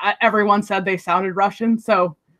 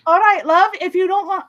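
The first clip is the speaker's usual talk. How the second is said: Helena Bonham Carter; 8 semitones higher than usual. Shown as a demonstration. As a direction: try out an actress's British accent, pitched up. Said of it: "a little bit of a Helena Bonham Carter".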